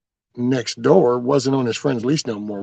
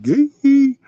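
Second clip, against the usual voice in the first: high, loud playful yell out the window; sudden shouted call; 14 semitones higher, 8 dB louder than usual. Retold or imitated. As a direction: holler it at the truck. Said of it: "I yelled".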